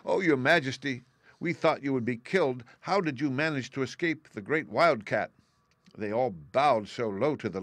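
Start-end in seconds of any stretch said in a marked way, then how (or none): 0.0-5.3 higher-pitched, anxious mouse subjects; exclaiming mice. A narrator's reading. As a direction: exclaim to your queen in a raised mouse voice. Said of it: "they exclaimed".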